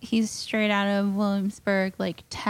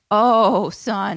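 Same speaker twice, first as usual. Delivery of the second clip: louder mock scold; 3 semitones lower, 6 dB louder than usual.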